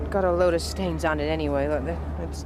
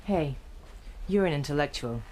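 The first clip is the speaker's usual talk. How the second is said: ordinary talk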